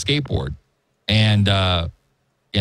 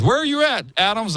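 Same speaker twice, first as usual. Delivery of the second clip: put-on old boss calling; 12 semitones higher than usual. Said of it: "And he goes".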